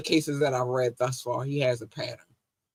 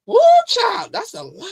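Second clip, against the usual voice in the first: shocked shriek; 8 dB louder than usual. Real, not acted. Reacting to the allegations, high loud shriek. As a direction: shriek it in disbelief.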